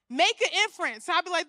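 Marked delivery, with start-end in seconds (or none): none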